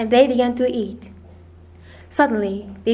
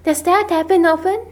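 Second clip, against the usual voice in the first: troubled question, pitched higher; unhappy question; 5 semitones higher than usual. A narrator's reading. Ermengarde hears her friend went hungry, unhappy. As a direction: ask with mild distress. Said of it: "said Ermengarde unhappily".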